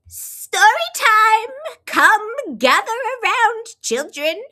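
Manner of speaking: put-on gather the children; announcing. Miss Otterbottom calls story time, high and loud.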